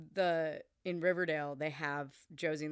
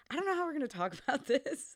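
laughing; 9 semitones higher, faster than usual. Retold or imitated. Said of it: "we were kinda like".

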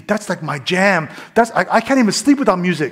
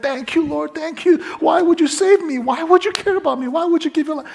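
pitched much higher, pleading in disbelief; overwhelmed prayer; 11 semitones higher, 3 dB louder than usual. Retold or imitated. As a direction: cry it out as if you can't believe you're saved. Overwhelmed prayer after throwing things away.

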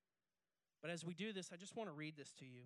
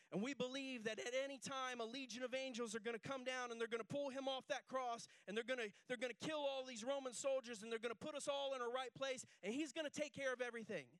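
raised, loud preaching voice; full-force preaching; 9 semitones higher, 7 dB louder than usual. Acted. Pastor preaching the crucifixion to the congregation, loud and raised. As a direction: preach it big to the crowd.

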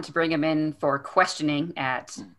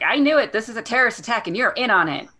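higher, louder indignant accusation; accusing; 7 semitones higher, 4 dB louder than usual. Retold or imitated. Cop Rudolph accusing Harry of a terrorist plot, indignant. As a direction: hurl the accusation like a paranoid cop. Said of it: "Rudolph is like".

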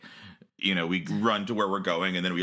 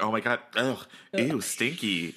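mock disgust; 4 semitones higher than usual. Disgusted by partner's breath.

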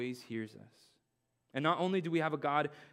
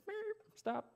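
squeaky high meep then stop; mock meep; 6 dB quieter than usual. Pastor mimics God halting him with a meep. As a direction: beep like a stop signal. God's stop sign as a car horn.

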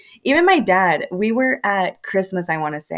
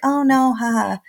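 higher, airy brush-off with a laugh; mock polite laughing reply; light laugh-off; 5 semitones higher than usual.

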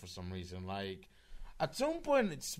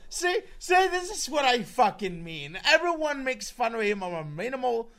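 exasperated; 13 semitones higher, 8 dB louder than usual. Real, not acted. Exasperated rant.

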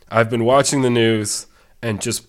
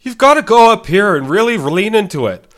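much higher and louder, leaning into a Canadian lilt; high-register Jordan Peterson; 10 semitones higher, 9 dB louder than usual. Shown as a demonstration. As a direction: push it way up into a high, loud register. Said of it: "I know it's in a higher register".

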